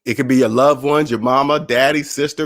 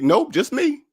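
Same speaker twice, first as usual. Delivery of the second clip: breezy retort; 11 semitones higher, slower than usual. Retold or imitated. Cheerful retort to incredulous listeners.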